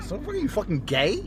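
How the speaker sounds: ordinary delivery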